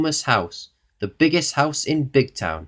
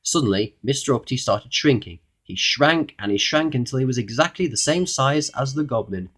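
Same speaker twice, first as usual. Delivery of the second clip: usual speaking voice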